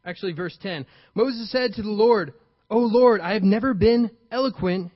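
ordinary delivery

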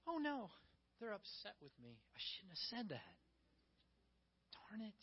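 hushed, sheepish self-reproach; mock dismay. Mock Jesus regrets upsetting the crowd, quietly dismayed. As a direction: fret softly as if to yourself.

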